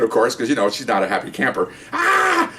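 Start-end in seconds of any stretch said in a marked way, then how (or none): 1.9-2.5 high mock shriek; imitated scream. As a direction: scream like a woman just knocked down. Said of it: "she's screaming".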